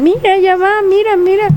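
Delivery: mocking kids. Older kids pointing and mocking.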